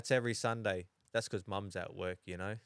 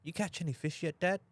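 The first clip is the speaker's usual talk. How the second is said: lightly voiced small child, pitched higher; higher, questioning kid voice, lightly imitated; imitating young son; 8 semitones higher than usual. Small son asking dad about the fishing, lightly imitated child. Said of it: "he'll go".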